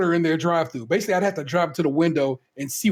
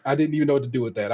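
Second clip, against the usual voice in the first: ordinary talk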